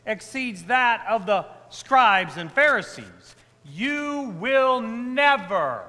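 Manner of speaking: Gospel reading. Acted. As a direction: proclaim the Gospel from the pulpit. Priest reads the Gospel aloud.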